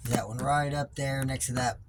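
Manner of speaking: talking while lying under a truck; lying under a car. Real, not acted. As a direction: speak flat on your back under a car. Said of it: "I'm under the truck".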